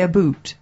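"boot" with a long oo, Canadian style; Canadian pronunciation. Shown as a demonstration. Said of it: "don't they say".

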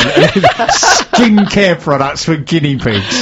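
slow, amused, laughing through it; laughing disbelief. Real, not acted. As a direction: savour the absurd phrase while laughing. Host repeats the niche, amused.

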